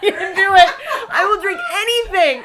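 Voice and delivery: loud laughing pep talk. Real, not acted. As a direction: laugh while egging yourself on. Psyching up while laughing.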